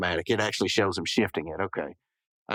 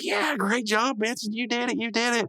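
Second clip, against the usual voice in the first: much higher excited cheer; park workers cheering Benson at the hole, high and excited; mock cheering crowd; 11 semitones higher than usual.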